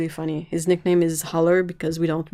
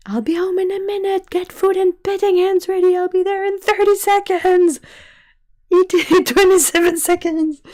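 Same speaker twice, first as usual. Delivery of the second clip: high, loud silly cat voice; 13 semitones higher, 8 dB louder than usual. Retold or imitated. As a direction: chatter like an excited cat. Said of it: "we always have them say stuff".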